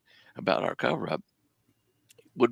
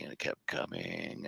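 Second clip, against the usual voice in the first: slower and softer, almost breathed; slow hushed repeat; 3 semitones lower, 9 dB quieter than usual. Retold or imitated. Storyteller to host, slow hushed build of suspense. Car approaching in dark desert.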